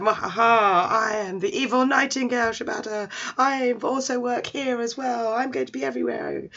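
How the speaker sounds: hammed-up evil reveal, pitched high; mock evil laugh into grand boasting; gloating villain. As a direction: announce yourself like a pantomime villain. Villain reveals himself as evil nightingale.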